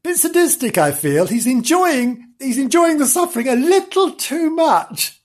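high, disapproving tut-tut; mock-shocked aside. Real, not acted. Narrator to children, high prim disapproval.

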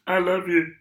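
high, emotionless puppet frog voice; flat frog-puppet I love you; Kermit the Frog voice. Retold or imitated. Actor mocked as sounding like a frog, imitating Kermit the Frog. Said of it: "he sounded like Kermit the Frog".

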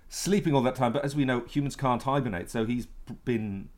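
ordinary talk, nothing marked

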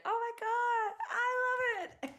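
high, loud excited squeal; [name] squeal. Retold or imitated. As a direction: squeal it like a thrilled fan. Imitating [name]'s excited reaction.

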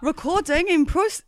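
high, slow attempt at an Australian accent. Co-host asked for an impression, high botched Australian accent. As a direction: start a bad Australian accent, then abandon it. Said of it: "please do your Australian accent".

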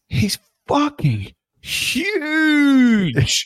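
"huge" stretched very long and high; huge held out in a high, rising-then-falling swoop; amazed emphasis. Real, not acted. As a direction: draw out "huge" in sheer amazement. Marvelling at a player's size.

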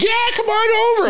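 very high, imitated lady calling out; high-pitched neighborly holler; old neighbor lady. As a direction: call a kid over from the yard.

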